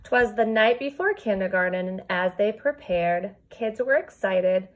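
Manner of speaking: lilting storybook read-aloud; reading to children. Acted. Teacher reading a rhyming book to her kindergarten class, storybook lilt. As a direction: read the rhyme to your class, gently bouncing.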